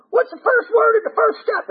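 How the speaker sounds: very high put-on quizzing voice; Rusty. Old-timer quizzing a relapser on step one, high-pitched scolding. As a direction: imitate a squeaky, insistent old-timer. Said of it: "he said it like this".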